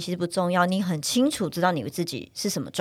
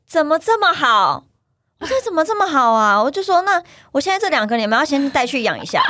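mock delight; 7 semitones higher, 5 dB louder than usual. Mother retorting to a pushy relative, sarcastically overjoyed and loud. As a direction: gush back sweetly to turn the tables. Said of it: "我跟他说".

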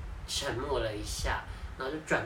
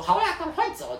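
high, louder mock snap; mom telling her to leave; 5 dB louder than usual. Mom sending her off, high mock snap. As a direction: shoo her out, fed up. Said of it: "她还在说".

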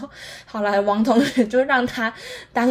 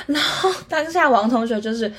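ordinary delivery

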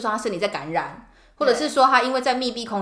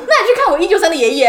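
playful senior nurse; 8 semitones higher, 9 dB louder, faster than usual. Pretend. Senior nurse's suggestion, acted.

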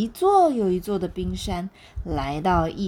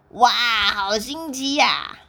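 louder, slow, delighted wonder; happy excited; 3 semitones higher, 5 dB louder, much slower than usual. Acted. 企鹅抵达赤道后惊喜欢呼，响亮而缓慢. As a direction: gasp it out with delight. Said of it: "企鹅皮皮高兴地说".

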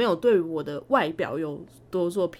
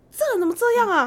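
mock disbelief; 12 semitones higher, 4 dB louder, faster than usual. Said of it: "我说".

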